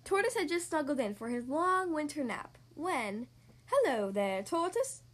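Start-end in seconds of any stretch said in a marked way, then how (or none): 3.7-5.0 lightly chirpy bird voice; chirping robin. A narrator's reading. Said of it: "chirped Robin".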